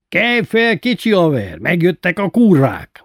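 loud, high wake-up call; rousing shout. A narrator's reading. Friend wakes the sleeping boy.

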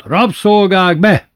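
high, loud shout to the slaves; bellowed command. A narrator's reading. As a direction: bellow it like a bossy tyrant. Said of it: "Bátyó középen ordított".

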